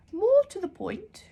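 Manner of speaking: a grand, half-deaf librarian calling out. Acted. Deaf librarian shouts while holding her ear trumpet.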